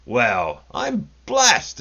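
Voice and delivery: usual speaking voice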